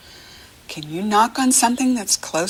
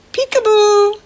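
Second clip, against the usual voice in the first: much higher, louder bright peekaboo call; playful peekaboo; 8 dB louder than usual. Acted. Teacher plays peekaboo along with the kids, playful call. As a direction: burst it out to surprise the baby. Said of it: "Can you play peekaboo".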